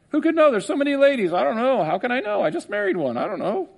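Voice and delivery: high, fading mock husband; mock clueless husband. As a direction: whine like a clueless groom. Mock husband unsure about marriage.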